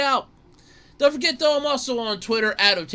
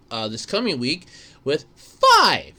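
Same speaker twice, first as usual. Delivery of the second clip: usual speaking voice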